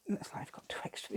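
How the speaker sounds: hushed, breathy whisper to herself. Real, not acted. Crafter repeats her remark about the butterfly in a whisper. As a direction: drop it to a private whisper. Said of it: "I'm getting carried away now".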